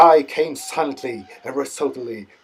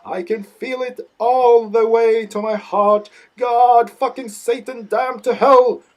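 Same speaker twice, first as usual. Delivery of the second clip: much higher, loud ecstatic howling cries; blattering and yodeling; 10 semitones higher, 7 dB louder, slower than usual. Acted. Grandpa crying out in ecstasy, high and loud. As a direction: wail it out so it carries for miles. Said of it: "He blattered and yodeled. You could hear him for miles".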